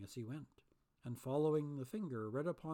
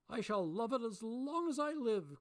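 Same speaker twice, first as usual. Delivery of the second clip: high, loud, delighted; cried out; 8 semitones higher, 4 dB louder than usual. A narrator's reading. Scrooge patting the knocker, delighted gush. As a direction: gush over it. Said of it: "cried Scrooge".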